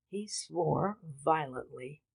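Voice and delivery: usual speaking voice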